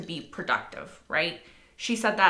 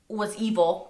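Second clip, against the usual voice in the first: ordinary talk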